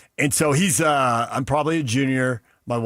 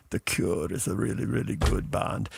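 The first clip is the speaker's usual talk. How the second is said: put-on Iranian accent; 4 semitones lower, 7 dB quieter than usual. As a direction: mimic a friend's Iranian accent, hushed. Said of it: "he had a heavy accent".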